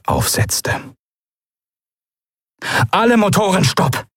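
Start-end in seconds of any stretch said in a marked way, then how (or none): 2.9-4.0 high, firm command; commanding order. A narrator's reading. Commander ordering all engines stopped, commanding. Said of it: "befahl Quailor".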